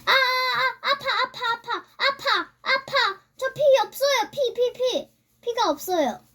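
high, rapid-fire yelps of alarm; panicked. Real, not acted. Player hit with no health left, panicked.

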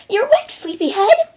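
very high, loud, perky pony squeak; high cartoon voice putting on cheer; Pinkie Pie. A narrator's reading. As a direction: chirp a forced-cheery greeting. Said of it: "the normally cheerful pony asked".

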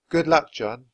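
usual speaking voice

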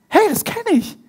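high-pitched startled realisation; sudden recognition. Acted. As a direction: voice jumps up as it dawns on you. Acted disciples suddenly recognise the man.

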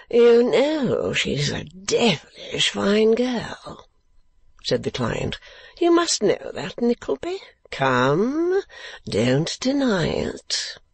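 high, slow foppish drawl; foppish young lord. A narrator's reading. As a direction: languid young aristocrat, high. Lord Verisopht voiced.

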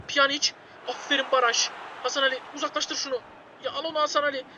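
ordinary talk

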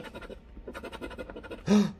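sudden breathless gasp of surprise; real startled gasp; sharp gasp. Real, not acted. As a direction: gasp as the prize shows up. Big win revealed.

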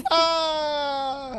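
high laughing yelp; death cry. Retold or imitated. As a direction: yelp like a dying creature. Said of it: "They went".

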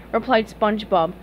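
ordinary delivery

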